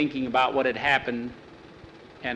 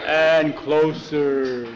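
slow, louder menacing shadow voice; a kid faking a scary monster; 6 dB louder, much slower than usual. Pretend. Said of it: "Jackie changed his voice".

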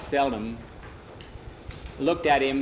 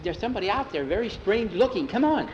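high, keyed-up report; urgent and wide-eyed, rising higher; excited urging; 6 semitones higher than usual. A narrator's reading. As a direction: hurry a friend along, pitch climbing. Urgently fetching a friend.

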